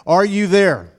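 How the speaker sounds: loud rallying call out; loud call-out with rising energy; loud call to the congregation. Real, not acted. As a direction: shout it out to wake the room. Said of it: "Let me ask it one more time".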